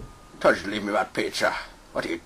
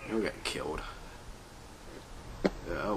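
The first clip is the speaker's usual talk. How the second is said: normal talking voice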